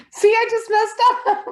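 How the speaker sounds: high, loud, through real laughter; laughing. Real, not acted. Presenter laughs to crew about messing up, laughing. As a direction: crack up over your own mistake. Laughs at botching her take.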